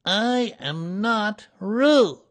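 high, very slow, loud protest; small animal's emphatic loud denial. A narrator's reading. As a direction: insist loudly, word by word, up high. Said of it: "said Piglet loudly".

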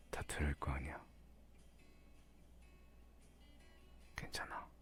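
hushed whisper so nobody hears. Acted. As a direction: drop to a secret whisper. Keeping quiet so staff won't hear.

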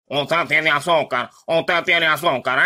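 mock robot repeat, low and slow; imitating computer.

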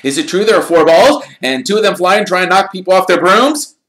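put-on high, loud, eager boy's voice; excited young fan. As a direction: gush at your hero like a starstruck kid. Colin asking.

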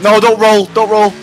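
high, loud panicked plea; panicked shout. Real, not acted. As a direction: beg the car not to flip. Car about to roll.

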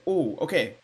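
ordinary delivery

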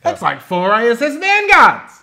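high, loud, drawn-out comic indignation; mock outrage. Pricing things in board games for a friend, mock-outraged shout.

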